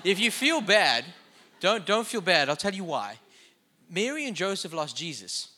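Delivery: ordinary talk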